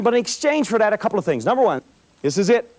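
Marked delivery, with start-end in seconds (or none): none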